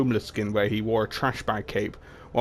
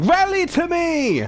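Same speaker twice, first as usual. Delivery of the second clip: much higher, louder slow held scream; 6 dB louder, slower than usual. Acted. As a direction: wail it out full force. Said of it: "before screaming".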